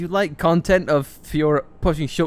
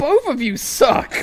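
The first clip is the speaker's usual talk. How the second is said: higher, louder and slow, laughing as he says it; laughing outcry; 9 semitones higher, 9 dB louder, slower than usual. Real, not acted. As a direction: drag it out through real laughter. Laughing at both characters.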